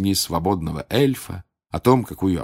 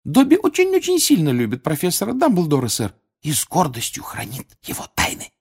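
high, devoted elf voice; squeaky Dobby; 10 semitones higher than usual. A narrator's reading. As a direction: proclaim loyalty as a little house-elf. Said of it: "снова запищал он".

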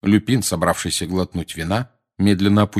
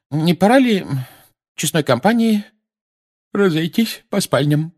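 sleepy Mrs Weasley; 10 semitones higher, 4 dB louder than usual. A narrator's reading. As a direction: suggest bed through a yawn. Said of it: "сонным голосом предложила миссис Уизли". (сонный голос миссис Уизли)

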